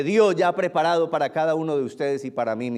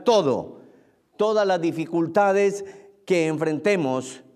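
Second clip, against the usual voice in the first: raised, insistent preacher's list; emphatic preaching; 4 semitones higher, 4 dB louder than usual. Real, not acted. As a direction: drive home each item to the congregation.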